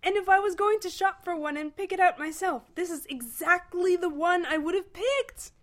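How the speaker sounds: high, loud, overjoyed gush; gushing delight. A narrator's reading. Ford praises Arthur's choice of spleen, gushing. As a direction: rave with swelling delight.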